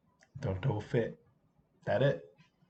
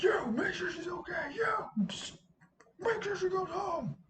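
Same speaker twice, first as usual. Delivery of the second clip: high, strained, half-voiced shouted order; mock yell; 5 dB quieter than usual. Teacher ordering friends to see a girl home, strained mock yell. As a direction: imitate yelling through a worn-out voice. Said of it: "I haven't yelled like that".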